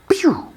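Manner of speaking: very high-pitched "pew"; playful falsetto pistol sound effect; imitated gunshot. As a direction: squeal out a toy laser shot. Imitates pistol shot at pipe vent.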